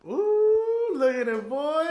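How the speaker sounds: high, loud hype cheer; frat bro cheer. Real, not acted. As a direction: whoop like a party bro. Cheering after the chug.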